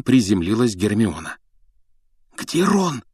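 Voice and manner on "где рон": frightened whisper. Acted. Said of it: "испуганно прошептала она".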